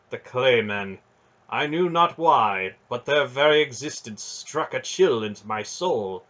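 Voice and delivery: the proud knight admitting a weakness; earnest knight voice. Acted.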